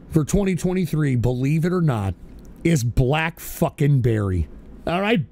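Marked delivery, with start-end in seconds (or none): none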